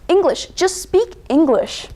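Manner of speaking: impatient son correcting his mother; exasperated demand. Acted. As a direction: scold her with slow, emphatic words. Son snaps at mother's Chinese.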